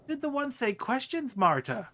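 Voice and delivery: high, questioning character voice; Marta. Acted. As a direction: puzzle aloud to yourself as an oddball. Marta asks herself.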